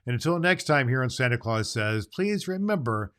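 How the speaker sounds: normal talking voice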